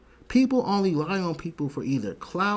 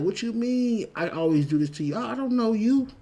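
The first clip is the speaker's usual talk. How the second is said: higher and louder pretend outrage; mock indignant reply; 11 semitones higher, 7 dB louder than usual. Showing Buck how to deny knowing the guy, higher mock outrage. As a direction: act out the denial Buck should have given. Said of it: "Or you coulda been like".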